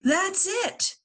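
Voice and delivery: high excited realisation; light up with an idea; Violet's high eureka; sudden idea. Acted. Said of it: "And Violet says".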